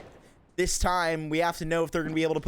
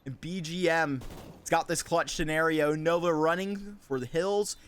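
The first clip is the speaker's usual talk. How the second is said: esports caster; 6 semitones higher than usual. Acted. Live esports play-by-play.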